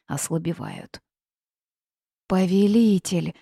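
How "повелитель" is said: ordinary delivery, nothing marked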